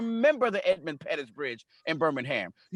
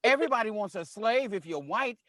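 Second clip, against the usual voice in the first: ordinary delivery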